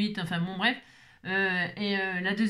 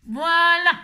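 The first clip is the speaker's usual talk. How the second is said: loud exasperated voilà; 7 semitones higher, 8 dB louder than usual. Real, not acted. Exasperated end of bad day.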